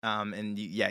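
ordinary talk

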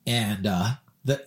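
ordinary talk

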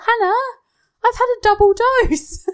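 high, loud excited announcement; excited retelling. Retold or imitated. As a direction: burst out with big news, voicing a friend. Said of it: "she was like".

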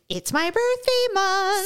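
slow, high mocking voice; mocking birthday person. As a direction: mimic a gushing birthday fan. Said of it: "people who go".

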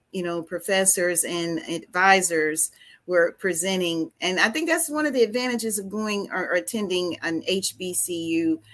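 ordinary delivery

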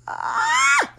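real fangirl shriek, high and loud; sudden squealing shriek of joy; excited high scream. Real, not acted. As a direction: scream with delight. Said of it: "I'm sorry, I had to scream".

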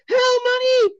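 shouted hell money. Acted. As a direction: bellow the temptation in full. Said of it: "and he says".

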